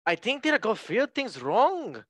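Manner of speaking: high, loud Scouse attempt that slips into Scottish; hammed-up Scouse with big Scottish-sounding swoops; botched Scouse accent. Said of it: "oh, that's, that's Scottish".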